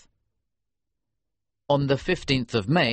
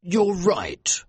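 agreeing judge; 8 semitones higher than usual. A narrator's reading. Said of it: "the judge agreed".

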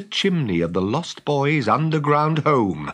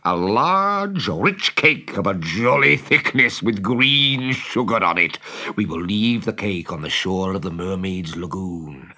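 villain relishing his plan, swelling louder; scheming Captain Hook; 3 dB louder than usual. Acted. Hook reveals his poisoned cake plan to the crew, gloating. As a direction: savour the wicked scheme with gleeful menace.

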